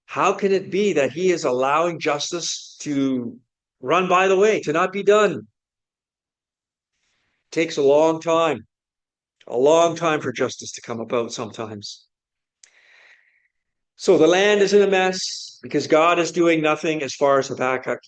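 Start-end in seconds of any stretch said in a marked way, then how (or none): 5.3-12.0 "long" stretched very slowly; slow, heavy emphasis on "long"; drawn-out stress. Shown as a demonstration. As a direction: draw out "long time" to feel its length. Preacher stretches out the words.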